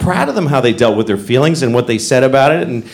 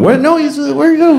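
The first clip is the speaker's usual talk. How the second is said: mock confused dad; 10 semitones higher than usual. Dad pretending dog ran off.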